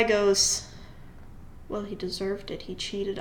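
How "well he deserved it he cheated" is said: softly muttered under the breath; under his breath. Retold or imitated. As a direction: mumble it so the teacher barely hears. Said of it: "under his breath".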